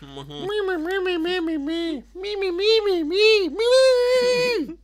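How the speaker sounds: very high, loud meeping squeak; Beaker voice. Acted. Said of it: "I'm working, Beaker".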